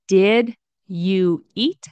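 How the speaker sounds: slow careful. Shown as a demonstration. Teacher giving the full careful form to students. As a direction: spell out "Did you eat?" word by word, slowly. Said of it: "if you want to say".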